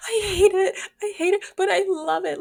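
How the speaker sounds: high, loud, tortured wail of hate and love; anguished love-hate cry. Retold or imitated. Reader wailing about a series she can't put down, high and loud. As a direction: cry it out like the book is killing you. Acted reaction to the book.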